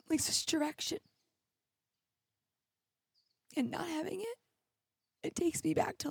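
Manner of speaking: tearful, breaking, pitch up; fighting back tears while sharing; crying. Real, not acted. Tearful podcaster admitting she misses her community, crying. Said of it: "am I literally crying over TikTok?".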